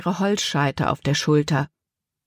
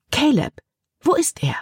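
higher call, searching the room; calling for someone; 9 semitones higher than usual. A narrator's reading. Libby looks round the cabin for Caleb, calling out. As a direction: call out his name, then wonder where he went. Looking around for vanished Caleb.

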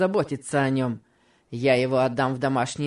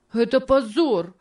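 high, pained cry of shame; a boy's outcry choked with sobs; crying out, holding back sobs; 8 semitones higher, 3 dB louder than usual. A narrator's reading. George cries out at Tom's sale, choking back sobs. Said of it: "кричал он со сдержанным рыданием".

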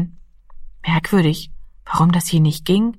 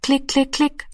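imitated clicking; 7 semitones higher than usual. A narrator's reading. The telephone dial rolls back, imitated clicking. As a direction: run of high, short dial clicks. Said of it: "rollte die Scheibe zurück".

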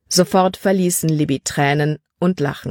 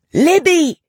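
much higher, loud call from far away; distant shout; 12 semitones higher, 5 dB louder than usual. Acted. Caleb calling Libby from afar, urgent shout. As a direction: yell her name across the clearing. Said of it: "Wie aus weiter Ferne drang Calebs Stimme".

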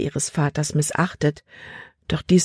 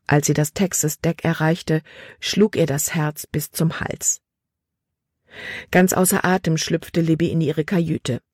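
plain reading voice

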